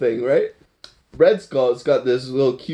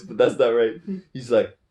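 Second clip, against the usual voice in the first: normal talking voice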